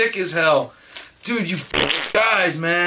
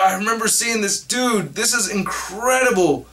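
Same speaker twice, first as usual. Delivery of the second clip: ordinary delivery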